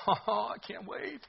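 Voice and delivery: high, slow, soft gloat with a laugh; gloating under his breath. Retold or imitated. Jonah muttering to himself he can't wait, gloating. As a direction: relish the doom quietly, chuckling. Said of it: "under his breath".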